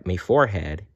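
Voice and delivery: ordinary delivery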